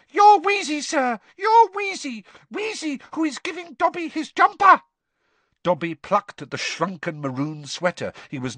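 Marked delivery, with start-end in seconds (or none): 0.1-4.8 high, loud elf squeak; squeaky house elf. Acted. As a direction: squeak it out urgently. Dobby voiced.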